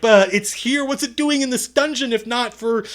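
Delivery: imitating a friend's high, loud protest; high-pitched, exasperated retold argument; pleading insistence. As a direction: plead your case, worked up and urgent. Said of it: "he's like".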